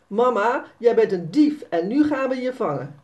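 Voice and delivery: a game-chase threat, mock fierce; mild mock threat to mum; playful challenge. Sanne playfully challenging mother.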